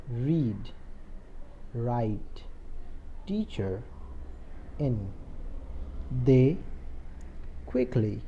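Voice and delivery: isolated words with long pauses for repetition; read-along pronunciation. Shown as a demonstration. Teacher has pupils pronounce along, slow word-by-word read-along. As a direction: spell and read out word by word, leaving gaps. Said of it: "आप उच्चारण करना मेरे साथ साथ".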